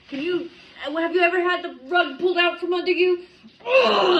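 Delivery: high, loud hammed-up cry; mock wailing. Reader wailing over the harsh card, hammed-up cry. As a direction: wail it like a fake heartbreak.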